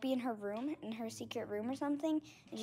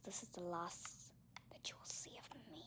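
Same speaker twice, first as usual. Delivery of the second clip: soft unvoiced farewell whisper; mock-tragic whispered goodbye; dramatic whisper; 6 semitones lower, 16 dB quieter than usual. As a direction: fade out in a whisper. Dramatic goodbye ending video.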